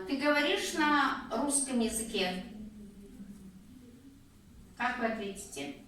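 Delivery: ordinary delivery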